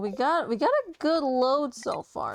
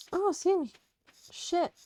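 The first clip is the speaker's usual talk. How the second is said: hiccups; 7 semitones higher than usual. Real, not acted. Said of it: "I'm, like, hiccuping a storm right now".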